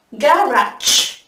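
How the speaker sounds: "Garage" as GA-razh; stress on first syllable. Shown as a demonstration. Teacher repeating for the class, "Garage" stressed on GA, ending -razh. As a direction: lean on the GA of "Garage", full a in the ending. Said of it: "nhấn vào âm đầu tiên".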